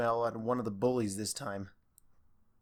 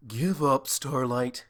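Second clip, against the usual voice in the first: bored; 4 dB louder, slower than usual. Acted. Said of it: "Spike added in a bored voice".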